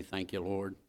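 a rising, repeated thank-you prayer; lightly preached prayer of thanks. Shown as a demonstration. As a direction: pray it with building praise. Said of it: "I don't think it hurt us every day to say".

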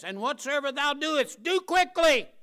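high, loud scripture quote; scripture shouted. Acted. Preacher hammering the twisted verse at the crowd, high and loud. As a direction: rise up and declare the verse. Quoting scripture with rising force.

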